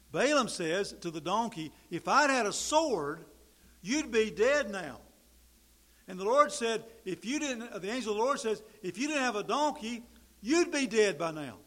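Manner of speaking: loud, fervent pulpit preaching, rising high; preaching at full force. Acted. Preacher to his congregation, loud and fervent. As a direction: build the sermon to a fiery peak.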